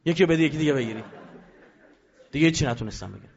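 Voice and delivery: ordinary talk, nothing marked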